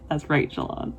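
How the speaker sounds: normal talking voice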